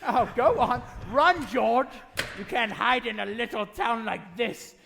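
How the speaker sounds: loud, cruel mocking taunt; gloating. Banker taunting George as he runs, gloating. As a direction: relish his ruin. Potter gloats, threatens arrest.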